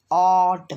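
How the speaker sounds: ऑट loud, with a long drawn-out 'aw' before the t; phonics word ending. Shown as a demonstration. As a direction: blend ऑट into one stretched syllable, 'awwt'. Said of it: "ओ टी".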